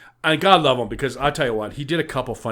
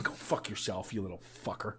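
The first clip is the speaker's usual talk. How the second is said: much softer, lower grumble; mock mutter; 5 semitones lower, 14 dB quieter than usual. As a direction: mutter it under your breath. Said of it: "I'm like".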